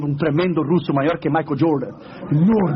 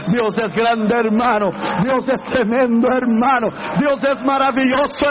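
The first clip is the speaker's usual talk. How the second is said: higher, louder, slow praise building up; full-force preaching; 9 semitones higher, 4 dB louder, slower than usual. Acted. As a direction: build the praise to a roaring climax. Preacher praises God at climax.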